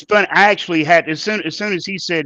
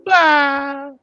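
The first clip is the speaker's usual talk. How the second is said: cheery bye; 10 semitones higher than usual. Real, not acted. Cheery sign-off.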